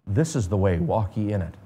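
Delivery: low, softened, tender word from behind; hushed, kindly low murmur; gentle guiding voice. Acted. Preacher voices God's gentle guiding voice.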